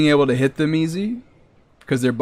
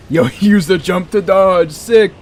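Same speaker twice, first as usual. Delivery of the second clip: loud, high hype laughing; pumped after a clean dodge; excited; 5 semitones higher, 6 dB louder than usual. Real, not acted. Excited about dodging.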